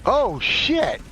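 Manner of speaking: startled swearing. Real, not acted. Gamer reacting to the game, startled swearing. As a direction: swear in surprise, pitch up then falling. Caught off guard during game.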